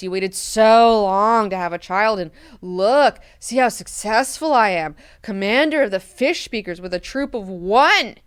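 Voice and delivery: loud, sneering sarcasm at her dad; bitchy daughter. Retold or imitated. Siona sneers at her father about surviving, loud and sarcastic. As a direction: spit it out as a resentful, bratty daughter. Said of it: "Being like".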